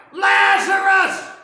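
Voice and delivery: huge, drawn-out call of the name; slow booming shout into the tomb; hollering. Acted. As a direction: call him out of the grave. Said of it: "he hollers out".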